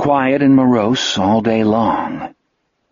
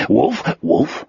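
lightly voiced happy creature; a little cheerful two-beat call; understated glad yip of words; Wolf, cheerful. A narrator's reading. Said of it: "Wolf said cheerfully".

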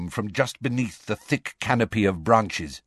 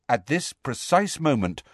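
usual speaking voice

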